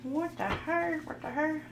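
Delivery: clown around with silly high-pitched disbelief; goofy. Pretend. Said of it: "I know I'm acting goofy".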